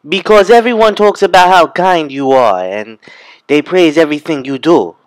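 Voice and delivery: loud, high, resentful complaint; jealous outburst. Acted. Manyara to her sister, jealous and loud.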